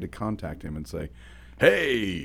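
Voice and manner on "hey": radio voice. Retold or imitated. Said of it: "I'll give him the radio voice".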